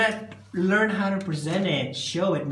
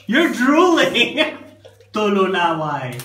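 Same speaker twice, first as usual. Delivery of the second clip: laughing; 2 semitones higher, 8 dB louder, slower than usual. Real, not acted. Laughs at child drooling.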